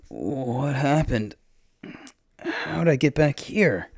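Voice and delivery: slow, high, dazed questioning; dazed awakening. Acted. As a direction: come to slowly, confused and dragging the words. Dazed awakening after rescue.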